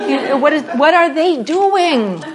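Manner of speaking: ordinary delivery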